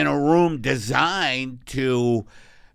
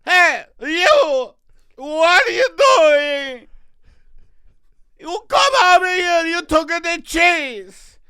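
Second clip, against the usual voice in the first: put-on high, shouting Italian old lady; old East Coast lady; 14 semitones higher, 7 dB louder than usual. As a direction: scold like a shrill immigrant grandma. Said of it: "an old lady who lives on the East Coast".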